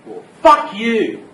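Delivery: slow, loud, spat-out curse; slow down and punch it loud; defiant loud retort. Acted. Defiant driver rejecting fussy fares.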